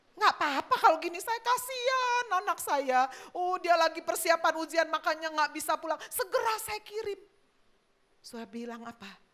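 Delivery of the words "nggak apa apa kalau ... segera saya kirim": higher, pitying father's voice; acted tearful sympathy; moved to pity. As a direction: melt with pity for your child. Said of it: "Bikin orang bisa kasihan".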